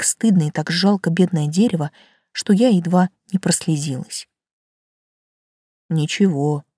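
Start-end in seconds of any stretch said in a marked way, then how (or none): 5.9-6.6 low velvety tree voice; a hint of husky tree; talking tree. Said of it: "низкий и бархатистый, с едва заметной хрипотцой".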